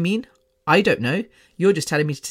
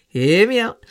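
"Hear me out" said laughing, higher and louder; laughing plea; 4 semitones higher, 4 dB louder than usual. Real, not acted. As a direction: laugh while pleading to be heard.

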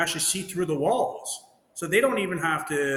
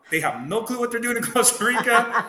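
higher and louder, laughing through the complaint; laughing rant; 7 semitones higher, 4 dB louder than usual. Retold or imitated. Telling Kaya about the license office, a laughing exasperated rant. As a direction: vent it half laughing. Venting about Costa Rican bureaucracy.

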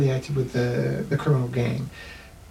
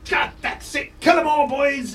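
gloating dwarf shout; 11 semitones higher than usual. Acted. Dwarf taunts the enemy guards, high and shouting. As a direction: crow it at the enemy like a battle-mad dwarf. Dwarf rallies his men.

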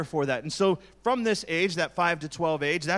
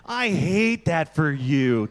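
slow, drawn-out mock pity; sarcastic drawl of I hate that for you; 4 dB louder, much slower than usual. Shown as a demonstration. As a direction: lay the fake sympathy on thick. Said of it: "I probably am being sarcastic".

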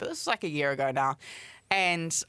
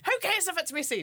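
high-pitched "who cares"; acted dismissive plea; 12 semitones higher than usual. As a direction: brush his worry aside, pleading. Acting out pleading with reluctant date.

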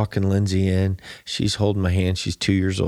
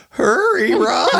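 high-pitched mock call to hurry; urgent imitation; 13 semitones higher, slower than usual.

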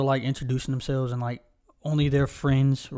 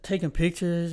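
ordinary talk

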